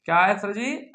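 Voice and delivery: ordinary talk, nothing marked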